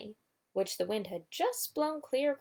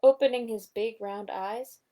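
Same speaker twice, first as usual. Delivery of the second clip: plain reading voice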